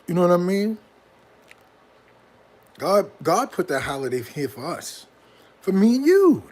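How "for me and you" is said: high, loud sing-song. Pretend. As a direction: croon it like a greeting card. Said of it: "That's how they even make it sound".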